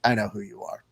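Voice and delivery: soft, deadpan and cold; bored, unimpressed mutter; flat unimpressed. Retold or imitated. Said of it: "The other reaction is me going like".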